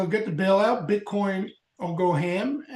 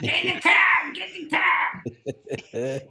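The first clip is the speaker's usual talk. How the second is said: put-on Boston accent; 10 semitones higher than usual. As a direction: holler it in a hammed-up Boston accent. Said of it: "I could have that Boston accent".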